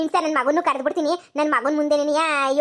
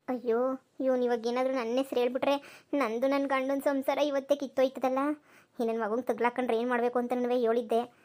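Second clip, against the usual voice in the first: hushed, much softer muttered aside to herself; worried aside; 2 semitones lower, 8 dB quieter than usual. Acted. Mother-in-law frets to herself about being exposed, hushed and much softer.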